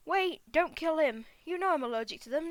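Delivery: a touch of alarm in the protest; urgent protest. Acted. Humans quarrel over killing a bee, urgent protest.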